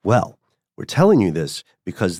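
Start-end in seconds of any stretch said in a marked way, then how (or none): none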